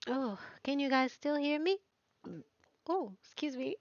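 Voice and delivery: ordinary delivery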